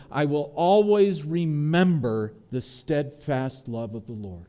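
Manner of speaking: ordinary delivery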